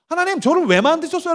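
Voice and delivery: high-pitched earnest appeal; imitated questioning of God. Child asks God why created, insistent. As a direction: demonstrate a challenge to God. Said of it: "라고 물어야지".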